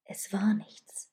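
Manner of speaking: slow, soft near-whisper. A narrator's reading. Man reassures the woman it was nothing, whispered. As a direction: hush it, drawing the words out. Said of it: "flüsterte er".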